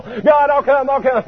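high, frantic begging; comic high-pitched panic; panicked plea. Retold or imitated. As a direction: beg God in a mock panic. Panicked plea facing the devil.

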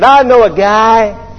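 high, slow, drawn-out boast; in-your-face. Retold or imitated. Driver right in pilot's face, mock-cocky. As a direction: imitate a cocky know-it-all leaning in. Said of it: "He got right in my face".

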